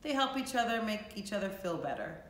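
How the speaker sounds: plain reading voice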